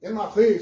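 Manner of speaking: "Enarré" with a guttural r, ending in stressed é; Erasmian pronunciation. Shown as a demonstration. Professor showing students the Erasmian reading, "Enarré". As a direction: pronounce "Enarré" without a k sound. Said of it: "seria uma pronúncia mais erasmiana".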